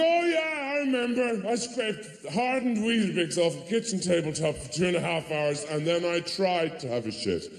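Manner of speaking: high, manic cheerful parent; high hammed-up frazzled voice. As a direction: brightly pretend it's all fine. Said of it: "You go".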